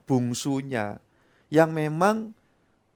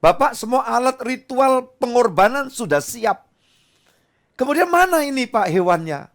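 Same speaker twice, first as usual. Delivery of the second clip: Isaac asking his father, pitched high; curious boy; 10 semitones higher, 5 dB louder than usual. Acted. Isaac asks father Abraham where the sacrifice lamb is, high and innocent. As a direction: innocent questioning child's voice. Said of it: "ditanya sama Isa".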